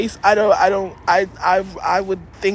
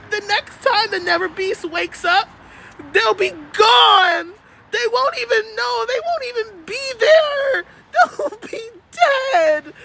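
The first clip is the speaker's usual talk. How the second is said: crying. Real, not acted. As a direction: break down recounting the sad ending. Sobbing over a sad movie scene.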